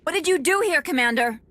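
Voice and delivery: high, loud demand for an explanation; alarmed confrontation. Acted. Captain confronts commander after fight.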